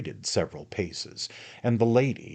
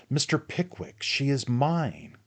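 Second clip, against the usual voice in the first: proud announcement; 8 semitones higher than usual. Acted. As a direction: savour the news like a triumph. Magnus announcing engagement.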